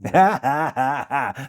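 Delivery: open, spaced-out ha-ha-ha; hearty laugh. Real, not acted. Speaker amused by his tree advice, hearty laugh. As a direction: laugh out at your own point.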